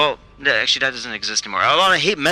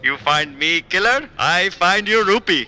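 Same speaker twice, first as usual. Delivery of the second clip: slow, higher, hammed-up Indian accent; 4 semitones higher than usual. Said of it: "Should I say it in my accent?".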